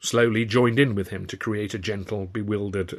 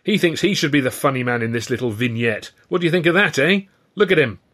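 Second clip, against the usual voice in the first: loud, raised voice playing to the crowd; high, taunting stage address; mocking showman; 5 semitones higher, 5 dB louder than usual. A narrator's reading. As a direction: point out a rival for public ridicule. Ed mocks Stan to audience.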